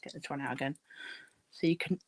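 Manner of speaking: talking around a paintbrush; words blocked by a brush in the mouth; mouth full. Real, not acted. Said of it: "sorry, I had paintbrush in my mouth".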